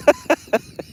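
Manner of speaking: a quick squeaky burst of laughter; tickled high-pitched laugh. Real, not acted. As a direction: let a very high laugh pop out. Laughs at a chat message.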